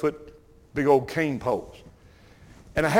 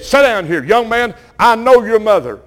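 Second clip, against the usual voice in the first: loud, higher scolding man; loud, much higher stern reprimand; stern guard; 6 semitones higher, 8 dB louder than usual. Retold or imitated. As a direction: lay down the law to a young thief. Store guard scolding caught boy.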